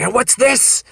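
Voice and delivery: high-pitched accusing question; imitating eager young cop. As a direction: pounce on it like an eager rookie. Young cop brandishes a suspicious find.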